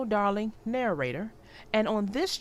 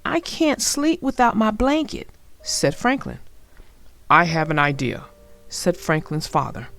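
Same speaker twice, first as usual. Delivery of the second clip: put-on clear, lively read-aloud for young children; storybook narration; 8 dB louder than usual. A narrator's reading. Narrator reading Franklin's Blanket to young viewers, storybook read-aloud. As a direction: tell the story warmly to little listeners.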